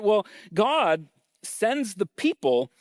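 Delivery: usual speaking voice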